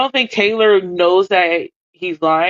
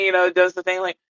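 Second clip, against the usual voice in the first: normal talking voice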